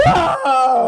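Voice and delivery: high, drawn-out wail of fake agony; badly faked scream of pain; fake pain cry. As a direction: pretend to be hurt, hamming it up. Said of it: "I really thought about going".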